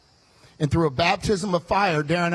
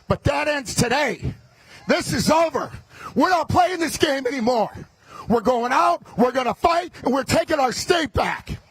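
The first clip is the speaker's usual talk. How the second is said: fiery rally speech; 8 semitones higher than usual. Acted. Candidate firing up the Republican crowd, shouting. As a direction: fire up the crowd at full volume. Rallies the crowd at full force.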